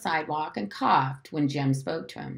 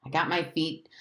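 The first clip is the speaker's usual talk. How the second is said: usual speaking voice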